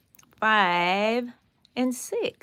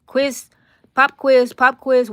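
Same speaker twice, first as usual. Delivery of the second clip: playful announcement; 4 semitones higher, 10 dB louder than usual. Acted. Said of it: "announcing a quiz".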